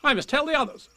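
high, important little announcement; self-important Rabbit. A narrator's reading. Rabbit off to tell the others, self-important. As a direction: pitch it high, full of importance. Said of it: "he hurried off importantly".

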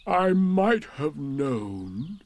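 very slow gloomy donkey; drawn-out long-suffering voice; resigned Eeyore. A narrator's reading. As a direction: drag it out in bitter resignation.

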